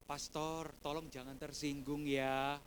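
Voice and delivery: low, slow, careful request; hushed-down, deferential imitation of a friend; gentle plea. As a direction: ease into it so as not to offend. Friend gently offers an envelope.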